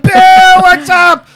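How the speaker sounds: loud, high-pitched holler of greeting; calling out. Retold or imitated. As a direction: yell to a pal across the street. Calling out to Bill in story.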